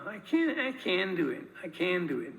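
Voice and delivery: American "can" with a flat nasal a; imitating an American "I can do it"; American accent. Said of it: "and Americans, uh, will say".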